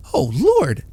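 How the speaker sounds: a loud, high burst of astonishment; excited exclamation. A narrator's reading. Washerwoman seeing the bills, excited cry. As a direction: gasp it out as the money appears. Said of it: "she exclaimed excitedly".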